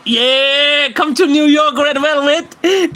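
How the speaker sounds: hammed-up catcall; mock catcall. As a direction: stretch Red Velvet out slowly. Teasing call.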